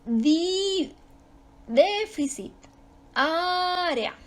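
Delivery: déficit and Área, first syllable high and held; stress on first syllable. Shown as a demonstration. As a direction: lift and stretch the opening of déficit and Área. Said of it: "Lo mismo pasa con la palabra".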